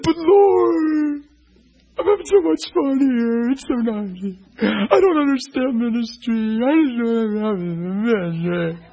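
crying. Retold or imitated. Weeping preacher pleading with the Lord about ministry. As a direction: sob the words out to the Lord. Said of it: "just start crying".

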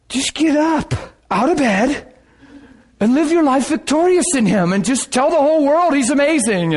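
urgent plea. Acted. Preacher silently urging the man out of bed, urgent plea. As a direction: urge him on with lifted pitch.

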